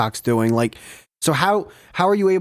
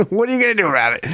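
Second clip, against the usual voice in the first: taunting dare, pitched higher; mocking dare; 6 semitones higher, much faster than usual. Retelling the dare to the old man, higher and mocking. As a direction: push his buttons with a smug grin. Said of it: "the classic line to get any man's emotions".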